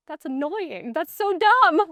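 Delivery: mock-annoyed outburst at the houndsmen; exasperated scoff. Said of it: "I was like".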